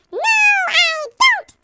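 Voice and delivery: high, loud desperate cry of no; servant crying out. A narrator's reading. Servant pleading with the king, crying out high and loud. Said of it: "the squirrel servant cried".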